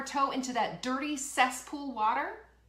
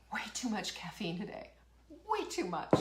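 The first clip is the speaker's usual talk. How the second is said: ordinary delivery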